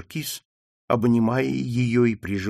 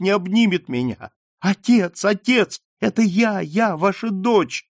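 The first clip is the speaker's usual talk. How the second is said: high, urgent, near tears; impassioned plea; 7 semitones higher, 3 dB louder than usual. Acted. Daughter pleading with her father to know her, high and urgent. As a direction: beg him to recognize you, voice climbing.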